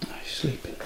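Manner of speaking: hushed whisper so he won't wake. Acted. Partner finding Billy asleep, hushed whisper. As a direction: breathe it under your voice.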